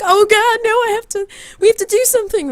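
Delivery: very high, frantic retelling; mock panic. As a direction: imitate panicking over a fallen friend. Retells panic as friend falls.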